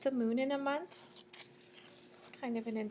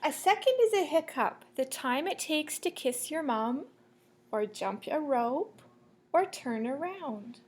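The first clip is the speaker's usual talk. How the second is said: lilting sing-song picture-book read; reading to children; 4 semitones higher, 5 dB louder than usual. Acted. Teacher reading a rhyming book to the class, sing-song. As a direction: read the rhyme to the class, bouncing.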